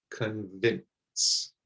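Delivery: "convince" quiet with a short i, not eye. Shown as a demonstration. Teacher showing learners the short i in "convince". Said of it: "it's not I, it's it".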